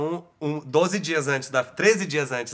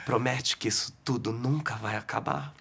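imitating a lover's hushed moonlit plea, whispered; romantic whisper; 7 semitones lower, 7 dB quieter than usual. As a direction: breathe it softly like a night-time promise. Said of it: "pegou assim e fez".

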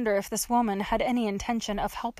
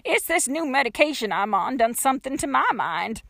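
put-on high, louder folksy old-lady voice; dotty old woman, rambling; 6 semitones higher, 5 dB louder than usual. A narrator's reading. Old woman explains her night to trapped girl, dotty rambling.

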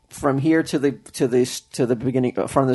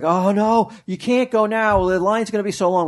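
higher-pitched mock child protest; protesting kid; 7 semitones higher than usual. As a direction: imitate a worried kid pleading from the back seat. Child protesting in retold story.

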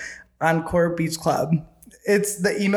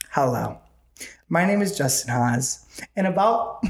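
formal reading voice; 4 semitones lower than usual. Shown as a demonstration. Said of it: "clears throat".

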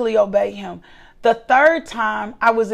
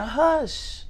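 soft hush; 5 dB quieter than usual. Retold or imitated. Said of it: "the Lord starts saying".

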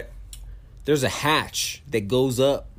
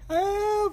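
mock falling cry. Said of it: "you just hear him fucking".

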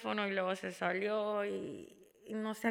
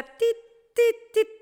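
high, loud electronic beeps; imitated monitor beeps; 12 semitones higher, 9 dB louder, slower than usual. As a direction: beep like a heart monitor. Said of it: "y empieza tit". (pitidos agudos imitados)

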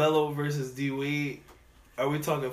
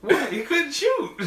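incredulous; 11 semitones higher, 7 dB louder, slower than usual. Real, not acted. Mock disbelief.

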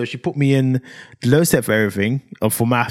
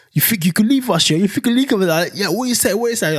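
higher-pitched challenge; pitched-up, needling accusation; mock confrontation; 7 semitones higher than usual. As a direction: act out an indignant playground confrontation. Acted confrontation retold by teller.

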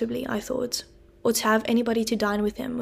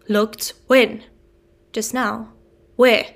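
sharp loud alarmed questions; alarmed demand; 7 dB louder, much slower than usual. Acted. Convict urgently questioning the boy, loud alarm. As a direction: snap each question out, alarmed.

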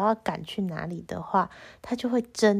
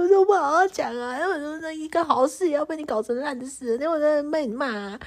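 retold crying; 6 semitones higher than usual. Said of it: "然后我就哭了".

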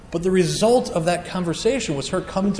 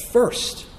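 "First" set apart alone, breathy and high; emphasized first; 7 semitones higher than usual. Shown as a demonstration. Preacher holding up the word "First" for the congregation. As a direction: isolate "First" as the key word. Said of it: "emphasizing this word first".

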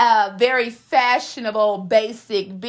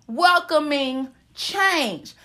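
usual speaking voice

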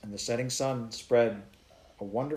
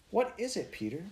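amazed question; 10 semitones higher than usual. A narrator's reading. Girl amazed at the glowing mountains.

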